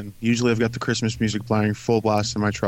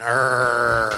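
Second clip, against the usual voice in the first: pirate growl. Acted. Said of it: "I was a pirate".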